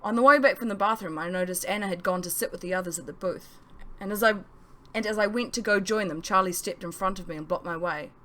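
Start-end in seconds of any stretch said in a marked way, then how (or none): none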